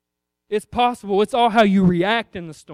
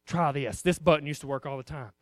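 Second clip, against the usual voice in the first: sly devil; 7 semitones lower, 8 dB quieter than usual. Acted. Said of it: "the devil says".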